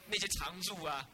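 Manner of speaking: ordinary talk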